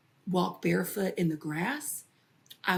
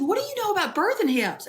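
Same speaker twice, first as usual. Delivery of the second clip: 1 mom's retort; 12 semitones higher, 5 dB louder, faster than usual. Retold or imitated. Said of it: "She said".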